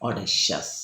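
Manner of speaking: audacious spoken slowly with a clean sha sound; slow teacher model of audacious with sha; correct pronunciation. Shown as a demonstration. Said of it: "അത് ഷ കൊടുക്കുക".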